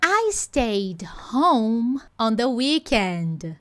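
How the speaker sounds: "I stayed home on the weekend" drawn out slowly; slow model sentence. Shown as a demonstration. Teacher models the English sentence slowly for students. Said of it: "Eu fiquei em casa".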